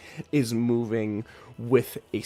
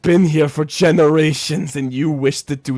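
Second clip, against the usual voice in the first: louder, higher rebuke; raised reproach; 5 semitones higher, 11 dB louder than usual. Acted. Argmith rebuking Thord loudly in front of the clan. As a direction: call him out forcefully.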